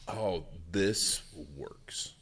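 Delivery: very slow, half-whispered imitation of realising; dawning realisation. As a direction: draw out "Oh, this works" in hushed discovery. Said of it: "when someone realizes".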